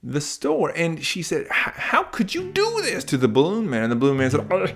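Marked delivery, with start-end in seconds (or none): 1.5-3.8 shocked mother. Acted. Mom shocked at floating son. 4.5-4.7 much higher, fussy shopkeeper voice; high flustered balloon-seller voice; high helpless balloon man. Acted.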